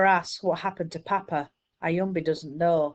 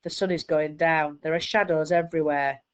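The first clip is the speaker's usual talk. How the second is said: usual speaking voice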